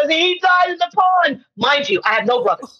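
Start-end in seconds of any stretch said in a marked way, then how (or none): 0.1-1.4 fake sobbing. Fake crying to teacher.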